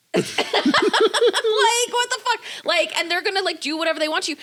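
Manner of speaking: high laughing disbelief; laughing squeal. Real, not acted. Laughing outburst, pitch way up.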